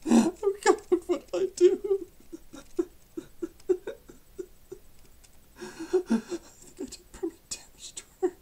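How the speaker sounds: high, sobbing, broken voice. Acted. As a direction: weep it out, barely holding words together. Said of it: "I'm getting tears everywhere".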